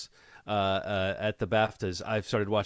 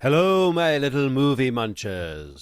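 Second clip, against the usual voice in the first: slow, hammed-up show host welcome; campy host greeting; 6 dB louder, slower than usual. Podcast host greeting movie listeners in a slow, hammy voice. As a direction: roll it out like a theatrical villainous host. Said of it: "That's how I'm gonna start every show now".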